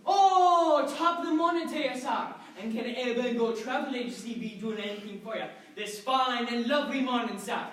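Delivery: hearty Irish travel agent; Irish accent. Acted. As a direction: greet a customer with a lilting Irish accent. Irish travel agent greets customer.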